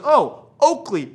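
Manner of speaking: put-on high, slow, loud kid; the cousin naming the brand proudly. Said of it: "And he goes".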